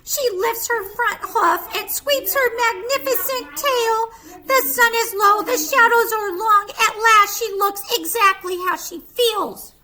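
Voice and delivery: lively picture-book narration; storyteller voice for little kids; reading to children. A narrator's reading. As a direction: read it like bedtime story time.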